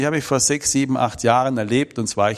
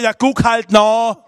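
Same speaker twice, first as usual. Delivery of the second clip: high, loud, slow insisting grandma; grandma urging the boy energetically; energetic grandma; 10 semitones higher, 7 dB louder, slower than usual. Retold or imitated. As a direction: insist firmly like a stubborn grandma. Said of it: "energisch geworden".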